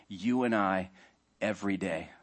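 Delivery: normal talking voice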